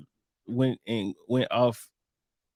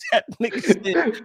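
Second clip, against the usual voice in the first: laughing through the words; giggling as he starts the quote; cracking up retelling the scene; 8 semitones higher than usual. Real, not acted.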